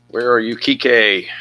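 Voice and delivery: calling out. Real, not acted. Searching for a card, calling.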